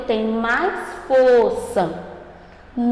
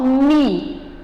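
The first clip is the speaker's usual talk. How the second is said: "Mi" dito com firmeza, vogal alongada; sílaba mais forte. Shown as a demonstration. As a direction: destaque o "Mi" como sílaba tônica. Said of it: "Olha como o som ficou mais forte". ("Mi" com mais força)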